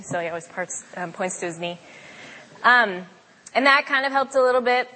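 ordinary delivery

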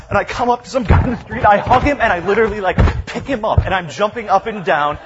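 high, loud, breathless-with-joy retelling; excited. Retold or imitated. Retelling hugging a stranger in the street, high and excited. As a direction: tell it bursting with excitement. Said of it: "I'm just excited".

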